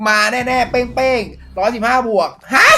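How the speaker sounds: slow, loud, high hype call; real excitement over the next pull; hyped shout. Real, not acted. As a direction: build it up then shout. Hyping next pack.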